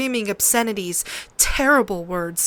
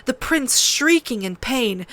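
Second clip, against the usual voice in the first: usual speaking voice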